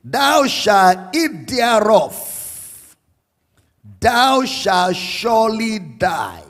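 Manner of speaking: THEREOF and DIE drawn out slowly; slow, deliberate scripture emphasis. Shown as a demonstration. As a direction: quote it word by word, weighting SURELY DIE. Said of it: "See the emphasis again".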